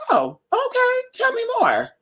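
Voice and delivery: very high, falsetto mock interest; high-pitched mock interest; mock eager listener. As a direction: put on a high, sing-song fake curiosity.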